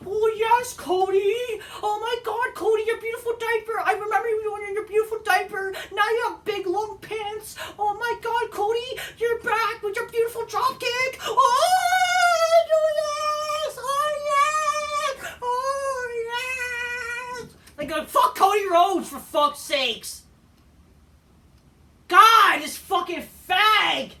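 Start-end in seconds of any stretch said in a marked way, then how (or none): 0.1-17.5 high-pitched, squealing mock ecstasy; gushing fanboy impression. Mocking smarks cheering Cody's return, squealing in fake ecstasy. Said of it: "smarks are creaming". 18.0-24.1 loud, raised-pitch cursing with drawn-out endings; exasperated angry outburst. Real, not acted. Fed-up wrestling fan cursing the winner, loud and exasperated. Ranting after mocking the fans.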